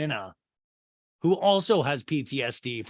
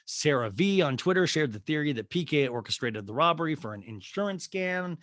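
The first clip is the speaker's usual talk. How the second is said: ordinary talk, nothing marked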